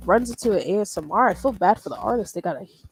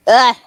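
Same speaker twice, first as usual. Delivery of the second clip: sharp loud sneeze; 3 semitones higher, 14 dB louder than usual. Real, not acted. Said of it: "Hate them sneezes".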